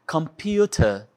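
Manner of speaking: "Computer" devagar, T nítido e sem R final; pronúncia britânica. Shown as a demonstration. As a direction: diga "Computer" como "compiúta". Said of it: "computer no British English". ("Computer" britânico, R mudo)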